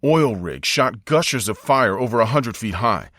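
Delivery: ordinary delivery, nothing marked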